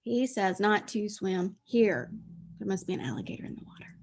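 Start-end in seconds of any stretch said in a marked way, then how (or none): 2.6-4.0 spooky whisper about the alligator; whispered aside. Acted. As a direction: hush it like a secret warning.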